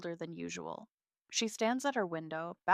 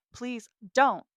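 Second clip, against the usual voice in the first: urgent plea, higher and louder; pleading; 6 semitones higher, 10 dB louder than usual. A narrator's reading. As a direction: beg them to stop. Dad pleads, gets cut off.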